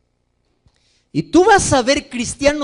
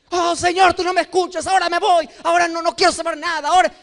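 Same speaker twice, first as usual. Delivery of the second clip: higher, fast petulant whine; sulky tantrum; 6 semitones higher, faster than usual. Retold or imitated. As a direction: storm off from God like a pouting child. Sulking believer threatens to leave.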